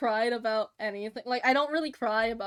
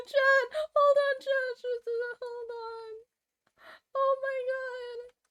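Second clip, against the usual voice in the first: much higher, strained cute voice; high cutesy squeal; 13 semitones higher, 3 dB louder, slower than usual. Real, not acted. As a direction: melt over the drawing in a tiny high voice. Overwhelmed by cute art.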